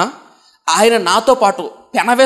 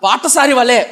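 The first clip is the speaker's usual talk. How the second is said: louder, pleading prayer voice; fervent prayer; 3 semitones higher, 4 dB louder than usual. Acted. Preacher voicing the devotee's plea to the Lord, louder fervent prayer. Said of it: "భక్తుడు ఒక మాట రాస్తున్నాడు".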